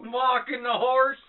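slow, high Southern twang; mock southern. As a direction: drawl it like a cowboy. Joins the Southern bit.

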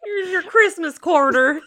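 high, laughing Southern voice; Southern accent. Acted. Repeating the Christmas quarter gag, Southern accent. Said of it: "give it a Southern accent".

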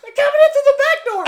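very high, fast, loud panicked squeal; hammed-up frantic manager; mock freak-out. Manager hears that robbers are coming, mock freak-out. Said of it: "The manager freaks out just as bad".

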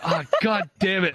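high-pitched, exasperated comic curse; mock furious. Streamer retelling giving in to husband, mock furious. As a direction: give in with a mock-angry groan. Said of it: "I'm furious".

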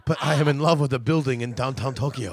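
put-on Japanese accent. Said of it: "first half of that no accent".

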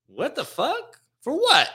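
put-on a shocked girlfriend demanding an answer; outraged disbelief. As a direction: react like she can't believe it. Girlfriend's acted reaction.

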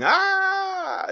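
high, far-off battle cry; robot battle scream. Retold or imitated. Retelling the robot's charge at the monster, a high battle scream.

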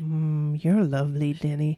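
very low, voiced pretend whisper; mock intimate murmur. Fan pretends to murmur into the singer's ear, low and flirty. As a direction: drop deep, as if into his ear. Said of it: "Can I whisper in his ear and go".

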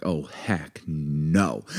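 low, quiet, very slow refusal; indignant resolve. Retold or imitated. Wife realises she was wronged, low indignant resolve. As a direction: stretch it out under your breath, dead set. Retells realisation before confronting neighbour.

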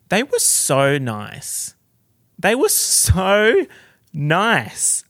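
"so" stretched long and loud; gushing emphasis. Real, not acted. Gushing about the cast to viewers, "so" hugely stretched. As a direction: lean hard on a drawn-out "so".

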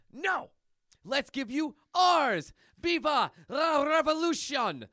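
loud, high rallying cry; defiant shout. Acted. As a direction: yell it like a rebel. Comic character yells defiantly.

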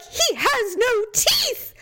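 high, slow, near-crying plea; mock exasperated. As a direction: wail it in mock despair. Retold plea to husband.

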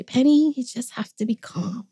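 a light impression of the patient older brother; gentle calming brother. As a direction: imitate him hushing a squabble softly. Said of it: "And he would say".

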